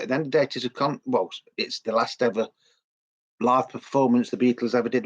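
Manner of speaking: ordinary delivery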